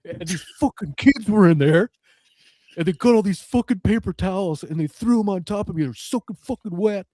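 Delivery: hammed-up outraged retelling; mock indignant Jay. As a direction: complain like the wronged victim. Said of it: "And Jay's like".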